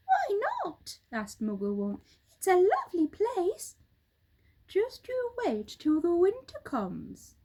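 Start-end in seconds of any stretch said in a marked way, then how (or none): 0.1-1.0 high squeaky monkey voice; a small monkey asking, pitched way up; monkey chief, high and eager; Mugglewump. Acted. 2.4-3.8 high squeaky monkey voice; a small monkey asking, pitched way up; monkey chief, high and eager; Mugglewump. Acted. 4.7-7.4 lightly raised bird voice; a hint of a bird's voice; roly-poly bird.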